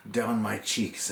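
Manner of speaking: plain reading voice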